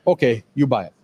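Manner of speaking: put-on light Korean accent, vowels dropped, brisk; mimicking Korean wife. Husband quoting wife's blunt Korean-accented approval. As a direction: quote his wife in her Korean-accented English. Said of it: "leaves all the, the vowels out".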